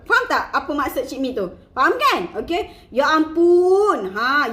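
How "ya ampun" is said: loud, slow, drawn-out AMPUN of rapture; swooning exclamation. Shown as a demonstration. Coach models reaction for followers, swooning. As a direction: stretch it wide as if overcome by flavour. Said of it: "Dia macam, nampak tak?".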